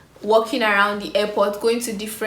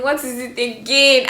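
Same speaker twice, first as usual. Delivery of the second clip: "again" pushed louder and higher; louder anxious repeat; 3 semitones higher, 5 dB louder than usual. Retold or imitated. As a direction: repeat the question louder, anxiety rising. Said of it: "I was having mad anxiety".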